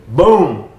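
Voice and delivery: loud sudden boom, blurted out; imitated explosion. Telling the host about the IED, a loud imitated boom. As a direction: burst out with the blast noise.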